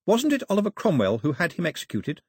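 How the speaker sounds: lightly voiced would-be princess; trying to sound regal. A narrator's reading. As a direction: put on a slightly regal air. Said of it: "trying to sound regal".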